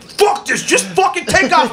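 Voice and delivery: high, strained heckle from the back; impatient passenger. Retold or imitated. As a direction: imitate a fed-up passenger calling out. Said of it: "one guy in the back's like".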